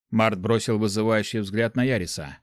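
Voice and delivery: usual speaking voice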